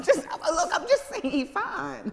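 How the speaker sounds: laughing through the words, high. Real, not acted. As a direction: giggle out the excuse. Laughing, recalling getting in car.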